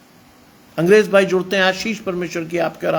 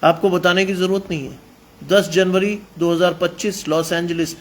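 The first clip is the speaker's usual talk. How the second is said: ordinary talk, nothing marked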